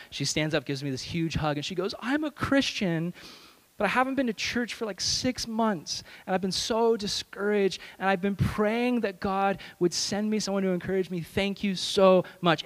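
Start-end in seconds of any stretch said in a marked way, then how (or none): 2.0-12.6 tearful. Retold or imitated. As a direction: mimic grateful, tearful words in raised pitch. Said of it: "She starts crying her eyes out".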